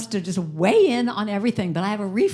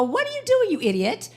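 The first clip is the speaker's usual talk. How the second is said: berating inner critic; 5 semitones higher, faster than usual. Retold or imitated. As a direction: scold yourself like an exasperated critic. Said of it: "We go".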